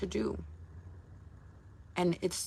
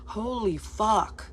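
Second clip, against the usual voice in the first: drawn-out, higher exasperation; overwhelmed; 4 semitones higher, much slower than usual. Retold or imitated. Streamer reacting to endless follower demands, overwhelmed. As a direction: stretch it out slowly, overwhelmed by demands. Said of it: "And I'm just like".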